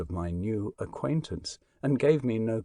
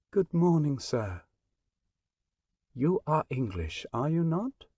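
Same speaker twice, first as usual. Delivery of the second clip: a lightly voiced stranger, sweet and clear with a hint of foreign accent; sweet clear contralto; 4 semitones higher than usual. Stranger answering the Englishman, a light sweet clear contralto. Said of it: "he said in a very sweet and clear contralto voice".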